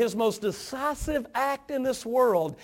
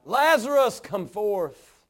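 loud command; 2 semitones higher, 3 dB louder, much slower than usual. Acted. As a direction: summon him out with a loud voice. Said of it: "it says with a loud voice".

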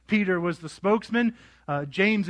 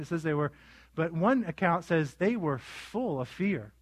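ordinary talk

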